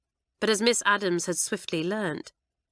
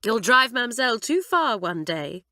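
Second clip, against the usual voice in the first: warning; 5 semitones higher, 4 dB louder than usual. Acted. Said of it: "warned Doris".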